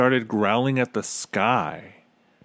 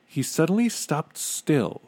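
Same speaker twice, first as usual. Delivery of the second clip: plain reading voice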